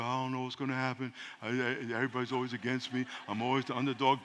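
hushed low prayer voice; quiet prayer. Shown as a demonstration. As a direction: pray it softly to yourself. Quoting a soft self-prayer.

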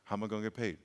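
hushed, low inner question; quiet worried reply. Retold or imitated. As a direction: ask it softly, unsure. Own reply in dialogue.

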